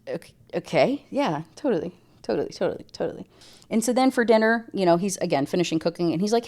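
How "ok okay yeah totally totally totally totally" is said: unconvinced murmur. Retold or imitated. Trailing unconvinced agreement.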